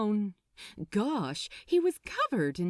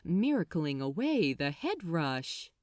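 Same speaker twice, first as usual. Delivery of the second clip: ordinary delivery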